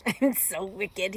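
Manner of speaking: loud, slow, self-delighted villain; gloating wolf. A narrator's reading. Wolf pleased with himself, wickedly gloating. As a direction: relish it slowly and loudly.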